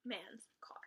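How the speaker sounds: usual speaking voice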